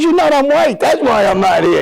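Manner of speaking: high-pitched mock exasperation; comic retort. Player answers friend about missing reunion, exasperated comic retort. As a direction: act out explaining the painfully obvious.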